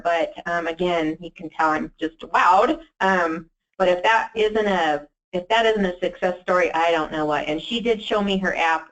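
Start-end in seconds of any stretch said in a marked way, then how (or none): none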